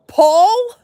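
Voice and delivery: teasing a viewer with a drawn-out question; calling out Paul. Real, not acted. Teasing viewer Paul about his big cook, mock surprised.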